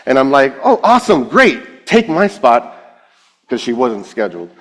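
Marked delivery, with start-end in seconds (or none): 0.6-2.6 high, hurried relief at escaping; mock enthusiasm. Leader handing the girl her spot, mock enthusiasm. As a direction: jump on the offer.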